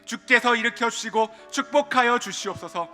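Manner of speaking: earnest, steady prayer voice. Acted. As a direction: pray aloud before the congregation. Leads congregational prayer.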